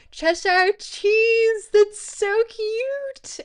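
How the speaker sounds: high, loud, drawn-out squeal of delight; gushing squeal. Real, not acted. Streamer gushing over Cheshire cheese, squealing with delight. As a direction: squeal it slowly, melting at the cuteness.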